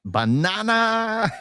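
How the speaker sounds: high, drawn-out Minion shout; Minion impression. Echoing the co-host's Minion catchphrase, high Minion shout. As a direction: squeal it like a Minion. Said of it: "I only saw the first Minions".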